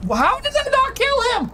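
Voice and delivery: exasperated high-pitched outrage; surprised. Real, not acted. Shot fails to kill enemy.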